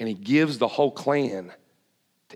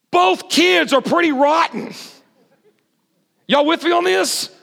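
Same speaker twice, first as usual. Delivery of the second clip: higher, louder shout to the crowd; full-force preaching; 4 dB louder than usual. Acted. Preacher tells the crowd both kids were rotten, shouting. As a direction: drive the point home to the room.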